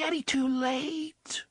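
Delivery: put-on high, slow, grieving child's voice; tiny mourning voice. A narrator's reading. Little child mourning that it is too late. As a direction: let it sink like a lost child's lament. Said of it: "the tiny mourning voice said".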